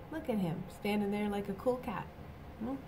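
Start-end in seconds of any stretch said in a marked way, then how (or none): none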